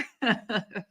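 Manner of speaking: brief real chuckle; tickled by the lawyer's demeanor; amused laugh. Real, not acted. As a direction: let a little laugh slip out. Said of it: "I'm laughing because Lewin's, uh, demeanor".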